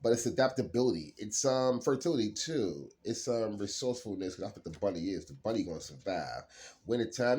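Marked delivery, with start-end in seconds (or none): none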